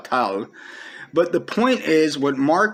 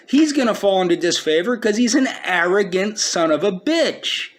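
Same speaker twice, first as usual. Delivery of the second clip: ordinary talk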